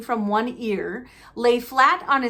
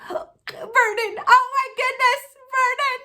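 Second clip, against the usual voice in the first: much higher, shrill panic calls; horrified shriek; 4 dB louder than usual. Acted. Wife shrieking at her husband over the letter, high and frightened. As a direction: gasp his name in terror.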